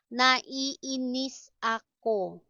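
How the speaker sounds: each syllable held separately; slow, careful syllable-by-syllable reading; slow teaching model. Shown as a demonstration. Teacher models an annoyance phrase for learners, slowly.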